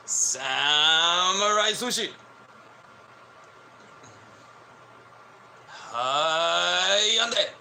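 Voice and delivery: high, hammed-up fake Japanese phrases; mock Japanese. Mocking Japanese speakers in a high put-on voice. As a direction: squeal pretend Japanese words up high. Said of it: "You don't hear Americans talking like that".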